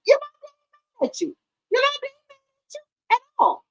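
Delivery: high, fast mock-innocent voice; teasing sing-song. Real, not acted. Vlogger teasing friend Yolanda, high mock-innocent sing-song. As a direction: tease her in a high singsong.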